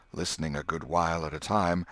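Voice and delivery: ordinary delivery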